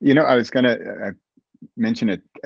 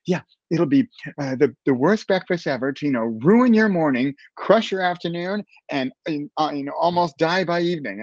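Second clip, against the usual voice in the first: higher, animated imitation; excited nutritionist; 7 semitones higher than usual. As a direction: pitch it up as she builds her idea. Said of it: "she was so excited".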